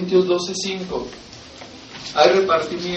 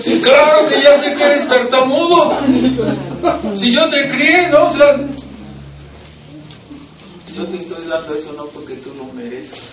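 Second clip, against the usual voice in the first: God answering; 4 semitones higher, 4 dB louder than usual. Retold or imitated. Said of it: "y voy a".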